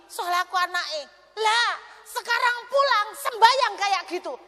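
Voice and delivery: shouting high and loud, fast; preacher shouting at his indebted friend; furious outburst. Retold or imitated. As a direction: pour out the anger at her, full force. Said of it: "Saya akhirnya nguamuk".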